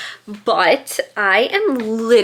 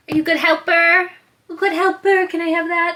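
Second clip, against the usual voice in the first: pet talk; 8 semitones higher than usual. Real, not acted. Talking to her dog Poe.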